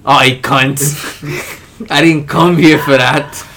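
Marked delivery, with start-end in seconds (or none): 0.0-1.9 a slow, hammed-up Australian accent; mock Australian accent. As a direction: drawl it like a cheeky Aussie bloke. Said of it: "That's what they talk".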